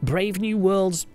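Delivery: usual speaking voice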